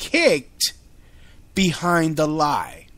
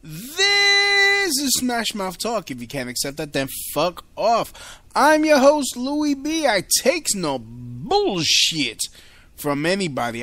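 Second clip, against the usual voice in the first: high, stretched-out show intro; show opener; 6 semitones higher than usual. Acted. Host opens Smash Mouth Talk for listeners, hyped announcer intro. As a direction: belt out the intro with long-held key words.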